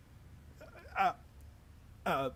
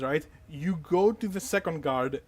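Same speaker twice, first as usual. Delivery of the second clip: normal talking voice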